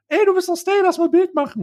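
put-on high, eager kid voice; childish mock fan voice. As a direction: squeak it out like an excited little fan. Kid fan voiced in retold story.

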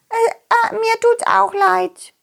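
put-on high, slow timid child; squeaky little worm saying sorry. Small worm apologizes. (hohe, zaghafte Kinderstimme)

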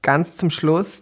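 ordinary talk

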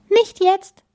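high, loud refusal; calling out. A narrator's reading. Bella refusing the space king, calling out. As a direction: call it out sharply in a girl's voice. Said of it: "rief Bella".

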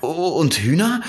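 high, hopeful question; excited fox. A narrator's reading. Fox asks the prince about chickens, excited. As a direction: pipe up eagerly as the fox. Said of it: "Der Fuchs schien sehr aufgeregt".

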